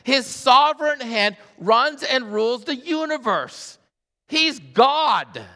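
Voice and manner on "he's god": preaching shout. Real, not acted. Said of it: "preacher declaring".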